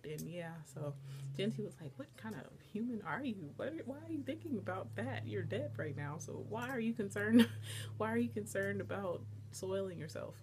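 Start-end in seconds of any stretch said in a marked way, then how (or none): 2.0-10.4 exasperated questions with swooping pitch; mock incredulous scolding. Genti questioning Mayu's worry about clothes, incredulous. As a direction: imitate someone baffled and appalled.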